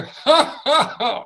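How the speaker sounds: high, loud laughing ha ha ha; warm-up laugh; belly laugh ha ha ha. Shown as a demonstration. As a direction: laugh it out from the tummy. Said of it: "we're going to go".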